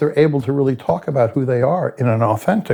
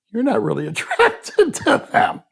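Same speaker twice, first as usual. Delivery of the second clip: laughing; 8 semitones higher than usual. Real, not acted. Laughing at the irony.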